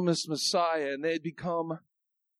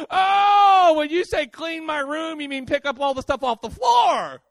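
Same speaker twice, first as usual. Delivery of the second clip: kid playing dumb; 7 semitones higher, 4 dB louder than usual. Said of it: "it's like they act clueless".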